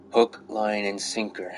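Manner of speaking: usual speaking voice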